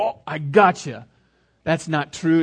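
loud, drawn-out gotcha; catching it out. Acted. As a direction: pounce on the lie out loud. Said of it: "You catch it and go".

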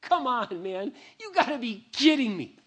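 high, loud cry of disbelief; disbelieving exclamation. Retold or imitated. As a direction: plead in stunned disbelief. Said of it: "I'm like".

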